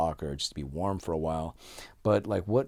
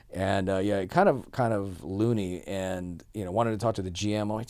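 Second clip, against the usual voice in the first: ordinary delivery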